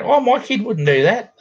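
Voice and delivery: a prissy mother brushing off the blame; mocking the mother. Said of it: "his mother come over".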